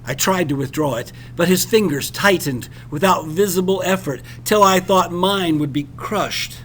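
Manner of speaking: ordinary delivery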